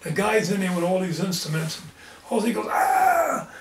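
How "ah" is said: mock scream. Investigator attacked by demon.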